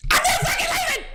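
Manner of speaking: very high screamed declaration; triumphant scream. Acted. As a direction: scream it like a victory speech. Hyped movie-line outburst.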